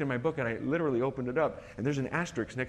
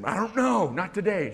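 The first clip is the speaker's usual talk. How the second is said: higher and louder, sing-song evasion; evasive dodge; 7 semitones higher, 4 dB louder, slower than usual. Retold or imitated. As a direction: brush off the wife's question. Evasive reply to wife.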